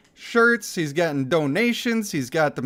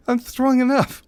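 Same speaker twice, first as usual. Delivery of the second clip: higher, louder lisp, stubborn; lisping Reza voice; 8 semitones higher, 4 dB louder than usual. Acted. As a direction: insist with a high lisp. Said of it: "get the lisp out".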